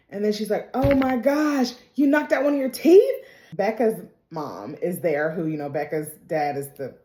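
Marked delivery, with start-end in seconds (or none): 0.7-3.1 high, loud imitation of an aghast stepmother; mock shock. Stepmother sees the knocked-out tooth, mock horrified. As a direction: exclaim in hammed-up alarm.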